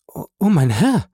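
frightened wife crying out to her husband; horrified plea. A narrator's reading. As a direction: cry out in horror, pleading. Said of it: "rief Frau von Villefort".

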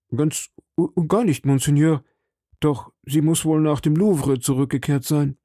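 frightened shopkeeper pleading with the cardinal; nervous shopkeeper. A narrator's reading. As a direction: play the anxious little shopkeeper. Timid shopkeeper voice.

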